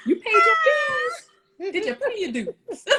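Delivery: high, slow teasing question dissolving into laughter. Real, not acted. Host teasing a commenter about society dues, high and laughing. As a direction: tease them playfully, then crack up.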